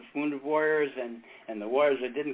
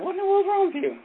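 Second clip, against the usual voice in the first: much higher, surprised questioning voice; high concerned wife's voice; wife asking. Retold or imitated. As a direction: play the startled wife asking. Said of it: "And he said".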